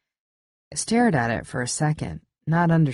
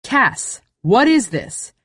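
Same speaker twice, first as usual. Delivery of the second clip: sharp demand; 7 semitones higher, 5 dB louder, slower than usual. Acted. Narrator confronts Cass.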